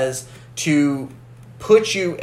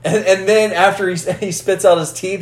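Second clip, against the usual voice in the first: ordinary delivery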